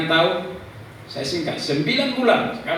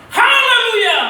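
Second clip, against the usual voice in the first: very high, loud hallelujah; jubilant shout; 10 dB louder, slower than usual. Retold or imitated. Father rejoicing in the delivery room, jubilant shout. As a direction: let the praise explode out. Retells shouting praise in joy.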